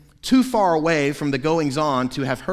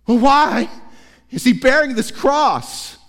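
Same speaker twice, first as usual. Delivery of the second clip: urgent question; 7 semitones higher, 5 dB louder than usual. Acted. Barabbas asks the crowd.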